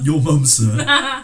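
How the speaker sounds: loud, high, drawn-out repeat through laughter; laughing echo. Retold or imitated. Echoing a friend's joke back, laughing loudly. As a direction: crack up while repeating it.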